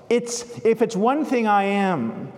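a hint of smug gratitude, heartfelt; mildly acted church lady beaming with thanks; Missus Turpin, with feeling. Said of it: "Missus Turpin said with feeling".

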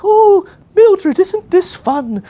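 put-on very high, loud old-lady warble; hammed-up doddering old dear, drawn out. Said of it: "these doddering old ladies".

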